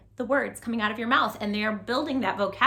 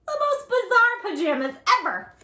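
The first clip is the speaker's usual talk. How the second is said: surprised; 11 semitones higher, 5 dB louder than usual. Shown as a demonstration. Story reading to children.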